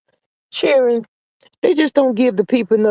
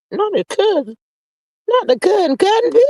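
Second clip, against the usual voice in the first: mock outrage; 9 semitones higher than usual. Real, not acted. Shocked correction, pitch climbing.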